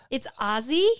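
Aussie with a Z, rising in question; questioned new pronunciation. Shown as a demonstration. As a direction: query Aussie as "Ozzie". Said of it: "Have I been saying it wrong".

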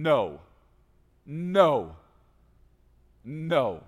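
loud repeated no, no, no; God cutting Abram off; firm no. Acted. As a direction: shut down the idea with a loud no. God refuses the servant heir.

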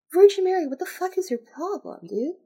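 high-pitched exasperated outburst. Real, not acted. Reader breaking off to scold Virgin Mary, exasperated. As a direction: scold her in disbelief.

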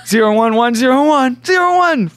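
stiff robot reciting ones and zeros; robot saying binary. Acted. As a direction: beep out binary like a machine. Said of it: "Is this another Robo Man joke?".

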